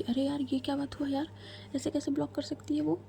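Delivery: a touch of exasperation, half to herself; annoyed muttering. Acted. Naina after being blocked, grumbling in annoyed disbelief. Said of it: "नैना गुस्से में".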